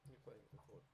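hushed off-mic scolding, half whispered; muttered aside. Real, not acted. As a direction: drop the voice for a side remark.

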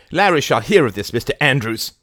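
high, loud haughty threat; indignant old lady. A narrator's reading. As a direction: threaten the bailiff. Lady Barbara voiced.

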